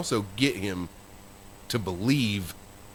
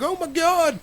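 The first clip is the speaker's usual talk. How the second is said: high, loud mock panic; imitated shock; 5 dB louder than usual. Friend waking up buried in dirt, imitated shocked cry. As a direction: exclaim it high, as a startled friend. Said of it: "And he was like".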